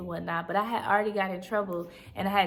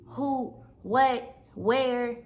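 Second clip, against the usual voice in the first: imitating mom's questions; 4 semitones higher, much slower than usual. Daughter imitating her mom grilling her in the back seat, mock interrogation. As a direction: fire off questions like an upset mom. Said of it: "She going off on me".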